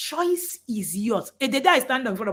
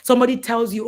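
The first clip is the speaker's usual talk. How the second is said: ordinary delivery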